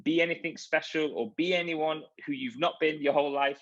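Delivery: ordinary talk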